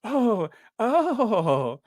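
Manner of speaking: high, wavering drawn-out "oh"; lady caught off guard. Retold or imitated. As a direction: imitate a flustered royal reaction. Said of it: "and she's like".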